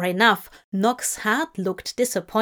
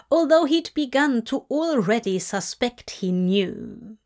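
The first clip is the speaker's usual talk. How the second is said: plain reading voice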